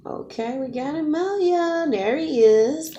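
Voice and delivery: high, squealing delight at seeing Amelia; real excitement. Real, not acted. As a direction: greet him with a burst of joy. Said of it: "I get excited".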